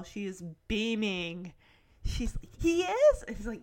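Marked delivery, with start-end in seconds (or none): 2.6-3.2 excited. Retold or imitated. Michelle beaming at the news.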